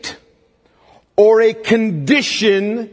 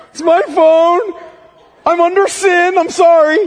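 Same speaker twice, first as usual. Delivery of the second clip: laughing through the words, pitched much higher and louder; real flustered laughter while owning up; embarrassed laugh; 10 semitones higher, 4 dB louder than usual. Real, not acted. Pastor laughing at his own ringing phone, embarrassed laughter.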